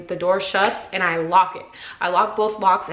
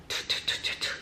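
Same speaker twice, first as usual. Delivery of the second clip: quick soft tongue-tap knocks; voiceless tut-tut knocking noise; imitated knocking; 11 dB quieter, faster than usual. Hearing the cop at the door, imitated knocking.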